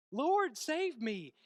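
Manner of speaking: high, loud, drawn-out plea; calling out. Retold or imitated. Peter calling to Jesus as he sinks, loud plea. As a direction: stretch each word into a slow cry for help. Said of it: "And he calls out".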